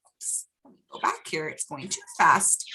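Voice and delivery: quick hushed aside; whispered aside. Real, not acted. Reader whispering a fix to herself, quick and hushed. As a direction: mutter the correction under your breath. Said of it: "aside about the video".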